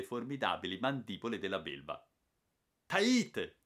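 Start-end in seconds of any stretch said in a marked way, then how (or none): 2.8-3.6 high, loud call; old hunter calling the porter in danger; shouted name. Acted. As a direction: yell his name sharply. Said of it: "urlò Cantagalli".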